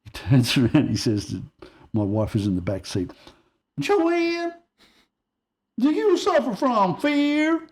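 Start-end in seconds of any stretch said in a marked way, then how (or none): 3.8-7.7 loud, high Southern preacher-like call; Southern drawl, slow and stretched out; deep Southern accent. Retold or imitated. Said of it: "with a deep Southern accent".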